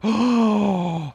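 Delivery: loud, startled gasp; big audible intake of breath; shocked gasp. Real, not acted. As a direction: gasp sharply in surprise. Startled by Sponge's news.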